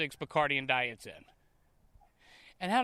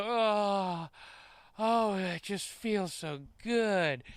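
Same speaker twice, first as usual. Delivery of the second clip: slow, moaning mock pleasure; reenacted moaning; 3 semitones higher, much slower than usual. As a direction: draw out the words like a hammed-up moan. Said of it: "can you reenact these moans".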